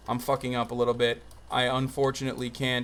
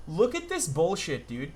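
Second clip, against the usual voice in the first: ordinary talk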